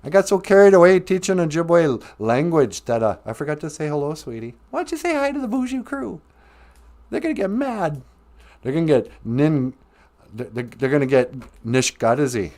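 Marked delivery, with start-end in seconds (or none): 4.7-8.0 higher, quick puppet voice; a lighter higher puppet voice. Acted. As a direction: nudge like a chatty puppet. Host coaxes puppet to greet.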